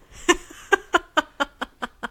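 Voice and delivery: big burst of laughter; loud laugh. Real, not acted.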